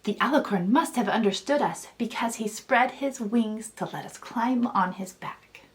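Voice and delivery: plain reading voice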